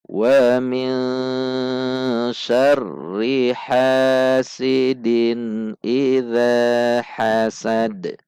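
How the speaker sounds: slow chanted tajwid recitation with long held vowels; measured Quranic chant. Shown as a demonstration. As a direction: recite it slowly, stretching the long sounds. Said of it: "Kita baca sekali lagi".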